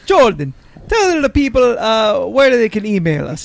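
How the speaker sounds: put-on worse Irish leprechaun accent; overdone high leprechaun brogue. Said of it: "The, the accent got worse".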